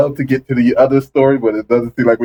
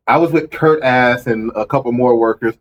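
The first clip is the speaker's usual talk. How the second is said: usual speaking voice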